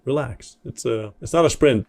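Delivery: ordinary talk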